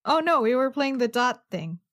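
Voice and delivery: normal talking voice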